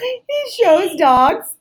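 high, very slow rising disbelief; incredulous echo. Real, not acted. Friend echoing the date's dog-show detail in slow disbelief. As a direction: drag it out, savoring the gossip.

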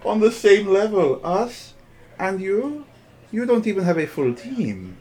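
high, incredulous scoff; mocking rival. Rival mocks Hal before her friends, derisive. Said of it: "turns to her friends, laughing, and goes".

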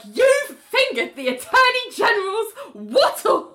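loud, high indignant accusation; scandalized cry, half laughing; mock outrage. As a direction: accuse him in hammed-up outrage. Acting out judge's outraged accusation.

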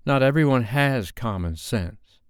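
snide Madame M. A narrator's reading. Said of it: "Madame M was glaring at Sophie".

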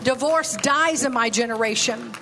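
a preacher's litany growing stronger; preaching declaration. Acted. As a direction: declare each one with rising fire.

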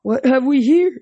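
high, loud booming god voice. A narrator's reading. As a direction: call it out big from under the car. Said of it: "a deep voice boomed".

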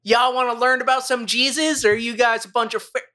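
swagger in like a crude preacher; rude preacher parody. Retold or imitated. Crude Jehovah's Witness at the door, high and cocky.

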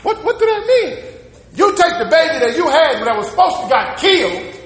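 ordinary delivery

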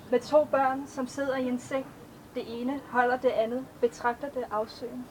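measured, even poetry recitation; a poet reading her own verses to an audience; poem reading. Acted. Author reads her poems aloud.

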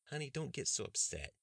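calm your upset lover down; soothing Bree. A narrator's reading. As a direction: partner easing his worries. Bree voiced by narrator.

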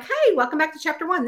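mock-peppy video opener; video host greeting. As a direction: chirp a brisk welcome to viewers. Re-enacts greeting video viewers.

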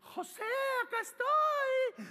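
very high, drawn-out temptress voice; hammed-up high-pitched come-hither call; seductive call. Seductress calls José, acted.

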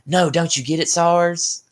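bad Southern drawl impression; hammy Southern accent. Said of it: "my Southern accent is horrible".

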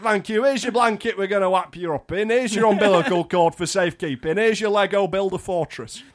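hammed-up doctor handing out items. Doctor giving newborn Lego at birth, mock cheerful. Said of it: "the doctor's like".

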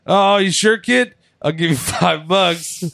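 imitating Sin Cara. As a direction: beg the kid to take it.

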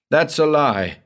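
sudden outburst. Acted. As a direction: snap it out in distress. Husband bursts out in anguish.

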